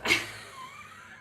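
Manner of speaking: very high squeal of a laugh; real laughter. Real, not acted. Streamer squealing with laughter at the broken stream. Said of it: "It's so funny".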